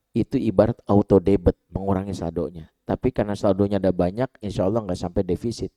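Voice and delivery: ordinary talk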